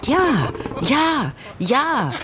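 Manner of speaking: high eager yelps; crying out. A narrator's reading. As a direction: squeal agreement like excited antelopes. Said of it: "schrien die Antilopen". (hoch und begeistert schreiend)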